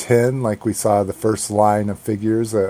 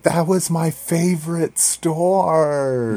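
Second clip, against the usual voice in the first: high, slow, sad little pout; wistful high girl voice; 9 semitones higher, slower than usual. Retold or imitated. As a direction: whine it like a let-down kid. Girlfriend quoted, wistful.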